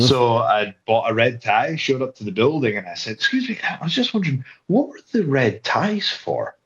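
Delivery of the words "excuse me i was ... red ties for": higher-pitched polite asking, re-enacted; tentative inquiry. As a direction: act out a puzzled, higher-voiced question. Acting out his puzzled inquiry at the building.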